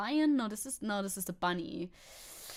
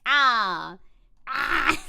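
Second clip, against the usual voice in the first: imitated dog scream; 3 semitones higher, 11 dB louder than usual. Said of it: "where he's screaming at you".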